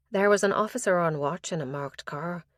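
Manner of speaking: ordinary delivery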